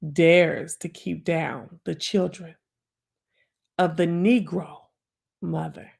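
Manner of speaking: slow, swelling recitation with hushed whispered lines; dramatic poem recital. Acted. Reciting The Negro Mother to her livestream audience, dramatic recital. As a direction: perform the poem like a stage piece.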